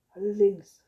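loud, emphatic exclamation; calling out. A narrator's reading. Father confronting his son, calling out. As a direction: call it out forcefully. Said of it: "rief der Vater".

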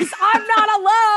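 high, loud joyful cry; excited shout. Real, not acted. Thrilled a friend also binged Grey's, a happy shout. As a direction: burst out in delighted relief.

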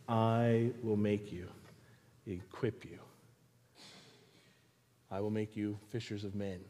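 low voice dropping very soft; Jesus. Acted. Jesus calling the doubtful believer, hushed low assurance. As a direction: answer quietly and low, with pauses. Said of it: "And we hear Jesus say".

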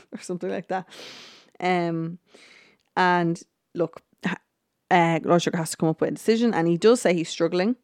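ordinary talk, nothing marked

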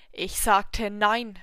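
loud, slow, furious repeat; furious shout. Acted. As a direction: roar the refusal. Devil repeats angrily.

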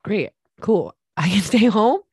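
put-on gleeful kid, loud and laughing; excited kid rambling. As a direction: play a kid thrilled to skip school. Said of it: "And if I was a kid".

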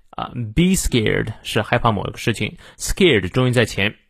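usual speaking voice

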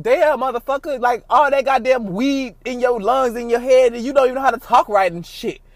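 mock exasperation at own stoned rambling; exasperated self-scolding. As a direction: scold yourself in disbelief. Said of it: "I was like".

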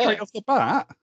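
high, incredulous question; real disbelief at the news; surprised. Real, not acted. Podcast host shocked the parents abandon the kids, incredulous. Reacting to story twist.

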